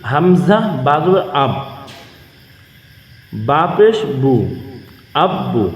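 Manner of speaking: slow, measured chant of letter and vowel, dropping low on the final syllable; slow recitation drill. Shown as a demonstration. Teacher showing viewers how to read tashdid with pesh, slow drill chant. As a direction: spell it out like a Quran teacher, falling on আব্বু. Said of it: "আমরা এখানে দেখতেছি".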